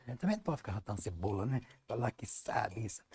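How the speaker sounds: soft, quick, fading to a whisper; modest hush. Retold or imitated. Painter modestly downplays his skill to the stranger, hushed. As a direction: say it low, not to boast. Modest aside in the retold talk.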